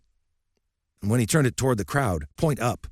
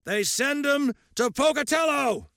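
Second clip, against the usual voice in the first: hollered. Acted. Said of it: "he hollered".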